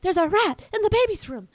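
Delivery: high-pitched alarmed cry, retold; mock panic. Lady warning Tramp about the rat, mock panic. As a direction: raise a hammed-up alarm. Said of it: "she, like, freaks out".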